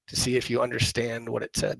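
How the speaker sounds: normal talking voice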